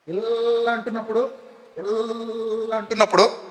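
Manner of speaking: soft, slow drone on a long "ilaaa"; droning ear-plug demo. Shown as a demonstration. Preacher demonstrates not hearing with ears plugged, soft prolonged drone. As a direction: stretch ఇలా into a quiet hum that blocks out speech. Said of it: "నేను ఏమైనా చెప్తే మీకు వినపడుతుందా".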